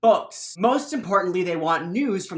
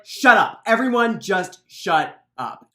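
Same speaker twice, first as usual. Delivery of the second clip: louder firm order, a bit slow; commanding shout; 7 dB louder than usual. Acted. Bran silences the crowd.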